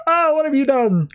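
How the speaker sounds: sharp high, shocked outcry; dismayed cry. Acted. Deathstroke discovers his tiny baby hands, shocked high cry. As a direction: recoil in shock at what they did.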